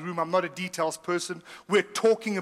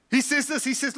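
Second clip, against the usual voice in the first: usual speaking voice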